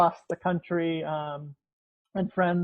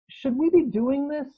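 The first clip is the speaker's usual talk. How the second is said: higher, lilting question; questioning quote; 9 semitones higher than usual. Acted. Someone asks the neighbors at the tree-felling, higher questioning voice. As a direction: voice the doubter with a raised pitch. Voicing a questioner within the poem.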